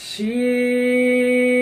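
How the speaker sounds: long sustained শিন, open sh sound; Arabic letter name. Shown as a demonstration. Teacher models শিন for students, slow recitation. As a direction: stretch শিন, teeth apart. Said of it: "আয়না দেখে দেখে বলুন".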